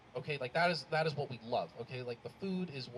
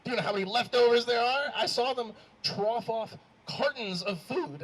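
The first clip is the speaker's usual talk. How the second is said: loud, raised-pitch comic outrage; indignant; 6 semitones higher, 7 dB louder than usual. Acted. Guest appalled at leftovers thrown out, indignant. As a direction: protest it loudly, stretching the end. Upset over wasted wedding food.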